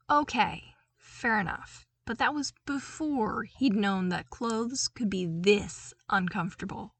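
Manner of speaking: ordinary delivery, nothing marked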